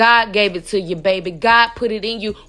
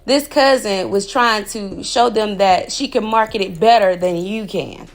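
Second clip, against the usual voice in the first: ordinary talk, nothing marked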